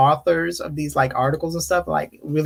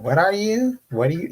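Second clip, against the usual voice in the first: mock nosy question, higher and rising; rude questioner; 5 semitones higher than usual. Strangers quizzing a mixed person, mock rude. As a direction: imitate someone rudely prying. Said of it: "You know, the rude people".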